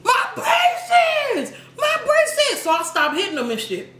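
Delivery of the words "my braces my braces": high shouted mock wail of a kid; imitating whiny cry. The beaten boy crying out, high mock wail. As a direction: squeal it high like the boy in pain. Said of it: "all I heard".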